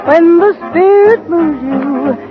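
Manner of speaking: usual speaking voice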